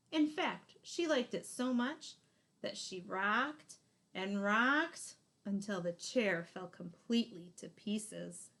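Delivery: ordinary delivery, nothing marked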